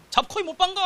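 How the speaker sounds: high-pitched reckless cry; re-enacted gambler's bravado, pitched high; gung-ho urging. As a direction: urge a friend to go all in. Acting out his reckless old self.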